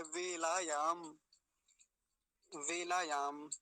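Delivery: वेलायाम slowed right down, long laa and yaam; careful v sound. Shown as a demonstration. Teacher repeating the word for students, slow careful v. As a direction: draw out वेलायाम syllable by syllable from a v. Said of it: "अब इसको वेला मत समझ लेना".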